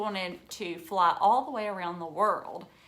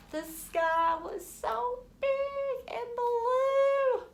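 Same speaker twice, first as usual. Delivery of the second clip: high, slow and dreamy; awed wonder; much slower than usual. Acted. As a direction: marvel at the huge sky. Wonder at flying in story.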